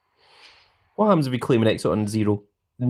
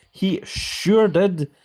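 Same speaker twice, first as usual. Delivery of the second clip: slow, higher, knowing drawl; wry drawn-out agreement; 6 semitones higher, much slower than usual. Real, not acted. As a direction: stretch it out with amused irony. Wry reply to chat message.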